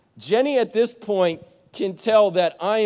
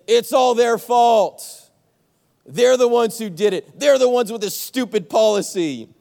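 indignant shout; 4 semitones higher, 6 dB louder than usual. Acted. Pastor voicing the congregation's blame, loud indignant shout. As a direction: shout like you're sure it's their fault.

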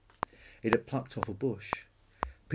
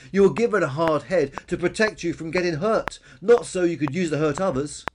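loud, raised, earnest scolding; reproving brontosaurus Billy; 6 semitones higher, 7 dB louder than usual. A narrator's reading. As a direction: lecture the bully firmly. Billy lectures Peter.

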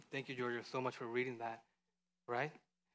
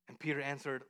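ordinary talk

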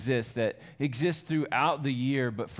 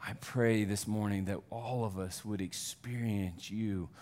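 prayer; 4 semitones lower than usual. Acted. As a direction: pray gently over the people. Pastor prays over the congregation.

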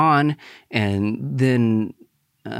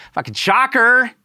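much higher, louder sarcastic cry; exasperated outburst; 6 dB louder, slower than usual. Real, not acted. Learning she hooked up with him, loud exasperated outburst. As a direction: blow up in mock disbelief. Reacting to her hooking up.

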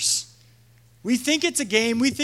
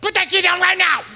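loud high stern yell; commanding shout; 9 semitones higher, 7 dB louder, faster than usual. Retold or imitated. Leader ordering a small girl, loud stern shout. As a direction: order it across the field.

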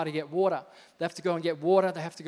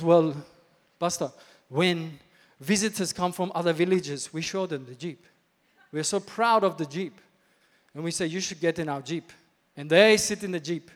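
put-on African accent, emphatic; proud chief in a hammed-up African accent; 8 dB louder than usual. Village chief boasting to pastor about Jeep, African accent. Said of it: "That's my African accent".

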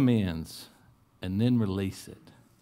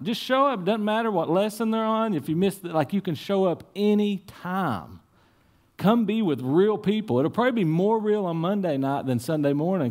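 fired-up preaching; 7 semitones higher than usual. Real, not acted. Pastor urging people to attend.

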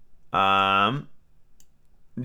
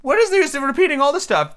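high, exasperated turtle voice; interrupting Mock Turtle; 5 dB louder than usual. A narrator's reading. Mock Turtle interrupting Alice, high and exasperated. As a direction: burst in, pitched way up. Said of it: "the Mock Turtle interrupted".

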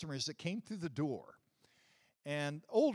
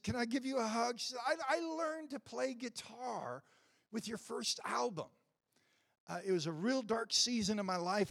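lightly voiced gushing woman, pitched higher; starstruck fan; 8 semitones higher than usual. Woman tells [name] his album carried her, lightly voiced and higher. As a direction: gush to your hero, a hint of a voice. She speaks to her music hero.

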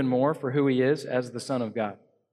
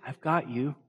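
I've got you said softer with a gentle rise; 4 dB quieter than usual. Shown as a demonstration. Pastor contrasting caring Jesus, I've got you tender. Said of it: "We're contrasting that".

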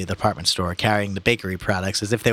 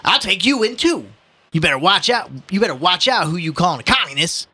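raised, indignant warning; threatening policeman; 9 semitones higher, 4 dB louder than usual. Acted. Policeman threatening to arrest the old man, indignant. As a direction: warn him off with ruffled authority.

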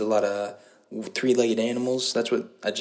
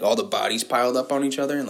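usual speaking voice

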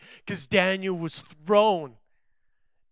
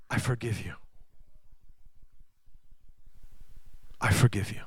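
tender forgiveness; 5 semitones lower, 8 dB quieter, faster than usual. Retold or imitated. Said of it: "God wants to say to you".

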